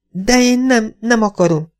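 high, pleading refusal; high, reluctant protest; protesting mother. A narrator's reading. Mother refuses, voiced in story.

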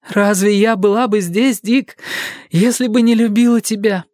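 put-on high, fervent girl's answer; exclaiming girl. A narrator's reading. Joanna answering Dick's plea for love, high and fervent. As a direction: answer him with passionate conviction. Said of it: "воскликнула она".

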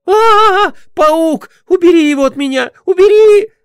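high, loud panicked screaming; terrified shriek. Acted. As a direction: shriek in terror at a spider. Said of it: "Вика завизжала".

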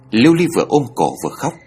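plain reading voice

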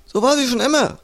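high-pitched soldier's call. A narrator's reading. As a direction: call it across the corridor. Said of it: "rief ein Soldat". (hoch herübergerufen)

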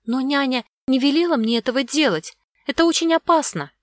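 put-on high, worried child's voice; high girlish pleading; anxious little girl. A narrator's reading. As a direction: protest timidly, pitch climbing. Scared girl protests to villain.

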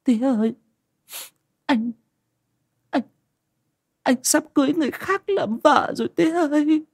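high, broken wail between stammered words; a daughter breaking down over lost love; sobbing daughter. Acted. Daughter telling her father the fiancé will marry another, high broken crying voice. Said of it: "cô lại khóc ngất lên".

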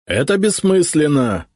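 high, loud exasperated retort; irritated. A narrator's reading. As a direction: snap back in annoyance. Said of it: "раздражённо заявил Прудников". (раздражённо)

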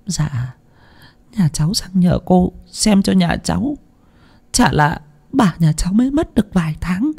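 frightened woman timidly asking a fortune teller for help; stammering timidly. A narrator's reading. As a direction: falter through it like a frightened peasant. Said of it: "ấp úng".

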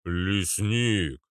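drawn-out droning boom; hooting character voice; Arnold. A narrator's reading. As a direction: hum it out like a slow giant. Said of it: "прогудел Арнольд".

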